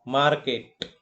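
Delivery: "Market" with MAR punched, KET lighter; stress on first syllable. Shown as a demonstration. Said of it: "the trace is on the first syllable".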